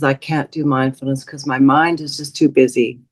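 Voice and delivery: usual speaking voice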